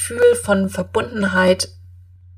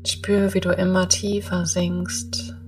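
guided meditation; 9 dB quieter than usual. Acted.

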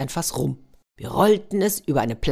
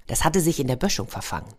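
plain reading voice

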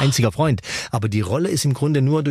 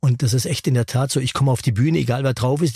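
ordinary delivery

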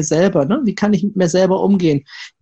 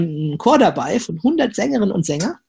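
ordinary delivery

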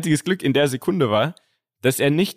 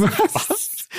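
fake surprise. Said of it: "Ich so".

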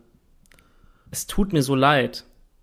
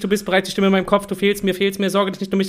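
reading lyrics faster; 5 semitones higher, much faster than usual. Shown as a demonstration. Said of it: "ich mach jetzt noch weiter mit dem Refrain".

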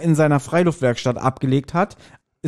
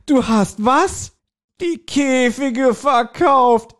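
much higher, very slow outraged disbelief; shocked disbelief; 10 semitones higher, much slower than usual. Acted. Justus, shocked at his aunt for selling the cages, high and slow.

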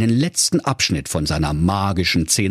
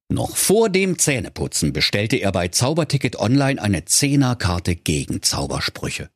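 plain reading voice

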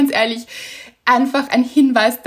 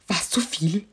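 softer, lower, a little sheepish; shy question; 4 semitones lower, 6 dB quieter than usual. Retold or imitated. Imitating the official's bashful question, softer and lower. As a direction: ask it bashfully, half laughing. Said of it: "Und sie so".

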